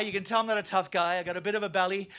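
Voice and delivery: normal talking voice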